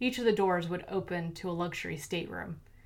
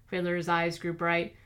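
ordinary delivery, nothing marked

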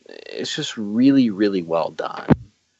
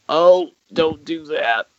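higher-pitched, drawn-out plea to the screen; acted viewer dread; 6 semitones higher than usual. Film fan voicing a tense audience, pleading dread. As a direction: wince out a pleading reaction. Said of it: "you're kinda like".

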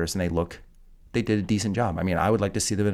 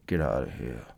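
"Get out of here." even lower and softer, mumbled from half the mouth; half-dead-face Rambo; 8 semitones lower, 5 dB quieter than usual. Retold or imitated. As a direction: say it like half your face is numb. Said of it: "Half my face needs to be dead".

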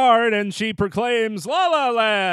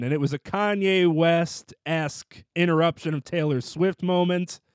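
ordinary delivery

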